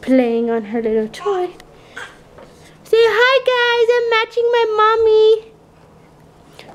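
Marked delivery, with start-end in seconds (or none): 2.8-6.7 put-on high baby voice, slow on the last line; voicing the baby. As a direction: speak for the baby in a tiny high voice. Mother voices baby to camera.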